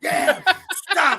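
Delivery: put-on high, laughing kid whine; a girl groaning stop with a laugh; mock annoyed daughter. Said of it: "she hates it".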